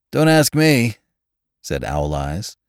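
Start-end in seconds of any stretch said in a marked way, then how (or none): 0.1-1.0 high, loud disclaimer; emphatic refusal of responsibility; washing his hands of it. A narrator's reading. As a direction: push the blame away, louder and higher. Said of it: "washing his hands of the whole matter".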